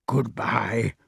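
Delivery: hushed, whispered goodbye; whispered farewell. A narrator's reading. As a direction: whisper it back. Companion returns the whispered farewell.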